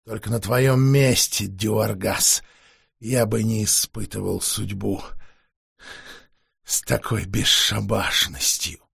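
exhausted vampire forcing out words; weary vampire voice. Acted. Vampire warns the sorceress.

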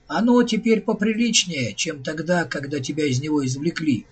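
plain reading voice

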